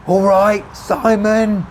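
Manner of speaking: high, loud hail; shouted greeting. A narrator's reading. Tommy hailing the narrator over traffic, shouted greeting. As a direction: holler a hello across distance.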